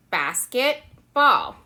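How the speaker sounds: two words, each said apart with a pause; basket, then ball, pulled apart; separate words. Shown as a demonstration. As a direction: split basket and ball clearly. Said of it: "let's use one hand for basket and let's use one hand for ball".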